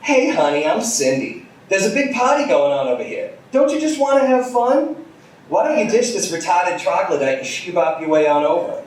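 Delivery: put-on high, bubbly Brooklyn party girl; Brooklyn accent. Party girl calls to the couple in a high Brooklyn accent. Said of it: "In a Brooklyn accent thicker".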